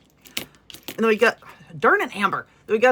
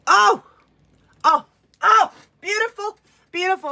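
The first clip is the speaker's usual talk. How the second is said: awed gush; 9 semitones higher, 4 dB louder than usual. Real, not acted. Gasps at holographic hook.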